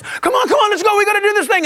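high-pitched, impatient chivvying; busy, hurried urging. Acted. Busy person rushing others to get things done, impatient. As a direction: hustle everyone along in a rush. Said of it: "when you're busy and you're like this".

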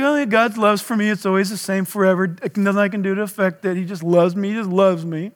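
mock spoiled churchgoer. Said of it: "It's like".

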